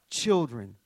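tender call. Acted. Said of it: "He forever cries out".

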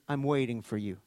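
tender Jesus voice. Acted. Preacher voicing Jesus' reply.

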